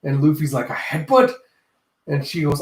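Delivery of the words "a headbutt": mock surprised question.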